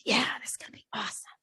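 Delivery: fast, whispered and sleepy; drifting off, whispering a happy plan. Retold or imitated. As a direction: whisper it to yourself in bed. Thought retold while falling asleep.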